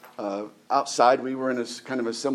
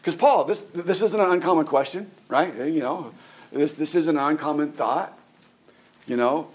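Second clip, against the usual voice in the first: ordinary delivery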